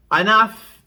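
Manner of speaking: loud stern mother's command; one loud command. Retold or imitated. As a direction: shut the quarrel down with one word. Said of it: "I said one loud".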